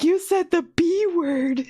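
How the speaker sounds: high-pitched mock shock; shocked watcher. Watcher shocked at hearing a curse word, high mock shock. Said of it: "he was like, kinda shocked".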